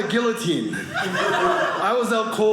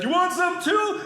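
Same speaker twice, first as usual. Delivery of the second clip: mock challenge; 11 semitones higher, slower than usual. Bar brawlers challenge the two brothers.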